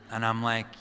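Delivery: normal talking voice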